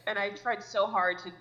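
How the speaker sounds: ordinary talk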